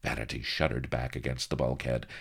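usual speaking voice

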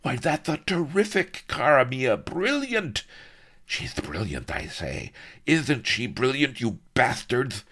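high, loud manic ranting; roaring villain. Acted. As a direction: roar at the officers. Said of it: "he roared".

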